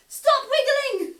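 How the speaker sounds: loud, slow and high trick order; booming ringmaster call; booming command. Pretend. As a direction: shout it out to catch them out.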